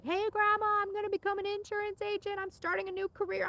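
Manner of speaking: excited news. Retold or imitated. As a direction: gush the big news upward. Telling grandma about new career.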